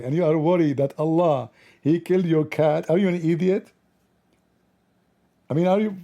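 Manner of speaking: building from stern to high exasperated; incredulous rant at a naive man; mock scolding. Scolding the admin, rising.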